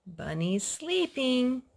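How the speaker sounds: ordinary delivery, nothing marked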